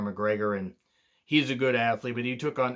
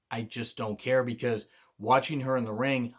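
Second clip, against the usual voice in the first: normal talking voice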